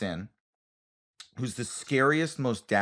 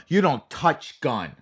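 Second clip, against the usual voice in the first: slow, louder scolding in broken English; scolding coordinator; 4 semitones higher, 4 dB louder, slower than usual. Retold or imitated. Coordinator scolding the actor about the gun, slow and stern. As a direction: drill the rule in, slow and stern. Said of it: "they start off with a scoldy attitude".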